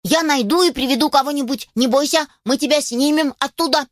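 high, eager puppy voice; urgent puppy. Acted. Puppy promises to fetch help, urgent and reassuring.

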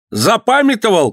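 high, accusing cry; shouted taunt. A narrator's reading. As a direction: fling the question out high. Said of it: "крикнул воевода". (обличительный высокий выкрик)

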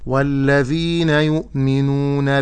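slow melodic recitation of the verse; Quran recitation. Acted. Sheikh reciting the whole verse on request. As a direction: chant the verse slowly with tajweed. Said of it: "الآية من أولها".

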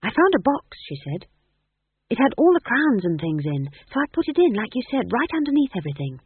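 rushing to report, still puffing; young witch arriving in a hurry, breathless. A narrator's reading. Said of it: "a breathless Magrat".

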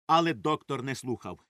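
plain reading voice

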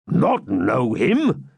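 slow, drawn-out bold retort. A narrator's reading. Friar challenged by Locksley about the knight, bold. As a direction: throw the question back boldly, word by word. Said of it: "replied the friar boldly".

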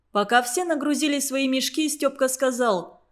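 plain reading voice

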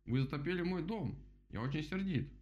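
high-pitched cross little mole; squeaky. A narrator's reading. Mole's house is flooded, squeaky and cross. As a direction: squeak an angry protest. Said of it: "запищал крот".

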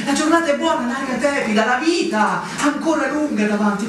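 ordinary delivery

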